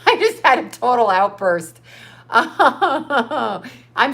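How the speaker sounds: slow, laughing "I'm sorry"; laughing apology. Real, not acted. Laughing after her rant.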